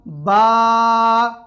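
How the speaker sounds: बा with a long drawn ā; long ā demo. Shown as a demonstration. Teacher demonstrating standing zabar on बा. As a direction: hold the ā in बा. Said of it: "तो पढ़ा जाएगा".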